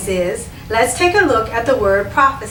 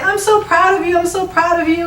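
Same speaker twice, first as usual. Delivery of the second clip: crying; 7 semitones higher than usual. Retold or imitated. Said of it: "started crying and said".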